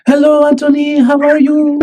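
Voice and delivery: put-on high-pitched French girl on the phone; French accent. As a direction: greet him in a lilting, high French accent. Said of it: "your French accent is so good".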